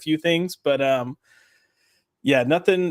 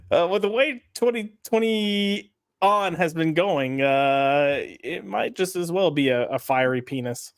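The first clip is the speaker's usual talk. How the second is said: ordinary talk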